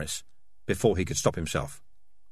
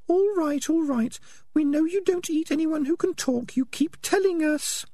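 very high, exasperated falsetto; squeaky female rat cutting off the excuses; impatient Peaches. Acted. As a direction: wearily put him off in a tiny high voice.

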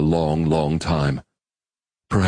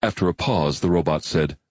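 usual speaking voice

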